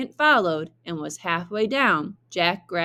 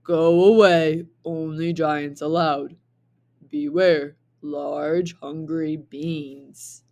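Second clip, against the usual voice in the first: giant sign voice; 2 semitones lower, 4 dB louder than usual. Acted.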